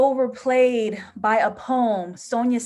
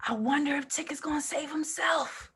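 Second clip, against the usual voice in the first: whisper; 5 semitones higher, 6 dB quieter than usual. Real, not acted. Hushed aside about the hero.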